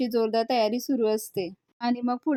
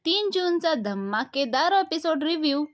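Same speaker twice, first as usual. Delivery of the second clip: higher-pitched presenter's opening greeting; show intro announcement; 5 semitones higher than usual. Acted. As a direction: announce the episode with a big, stretched finish. Channel intro in announcer style.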